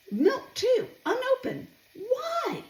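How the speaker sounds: high-pitched, incredulous protest; wailing in dismay at the waste; exasperated disbelief. Real, not acted. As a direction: let exasperation push the voice way up.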